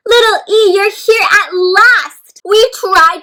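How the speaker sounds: the letters cheering for little E; excited cheering. Acted. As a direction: read it like a happy crowd.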